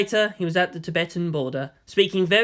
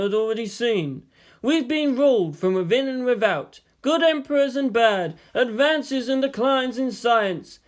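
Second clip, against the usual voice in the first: higher, slower sage voice; lightly voiced Yang; 6 semitones higher than usual. A narrator's reading. Yang lecturing Shinji on China, slow older man. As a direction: lecture him like a patient old mentor.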